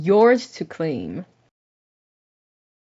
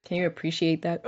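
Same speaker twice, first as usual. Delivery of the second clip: soft, subdued show of crying; pretend crying; 3 semitones lower, 10 dB quieter than usual. Host showing the co-host they can cry, pretend crying. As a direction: quietly play at being tearful. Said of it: "Can you see that I'm crying".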